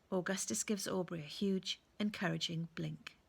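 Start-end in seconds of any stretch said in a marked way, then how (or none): none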